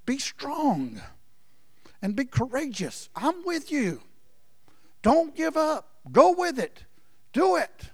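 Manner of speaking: God encouraging the fearful; God's encouragement. Acted. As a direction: urge them on like God cheering. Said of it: "God says".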